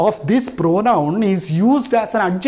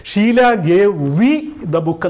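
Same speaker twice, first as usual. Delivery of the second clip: slow wrong example; much slower than usual. Shown as a demonstration. Teacher shows students the incorrect sentence, slow and marked. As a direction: spell out the wrong version deliberately. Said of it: "എന്നല്ല പറഞ്ഞത്".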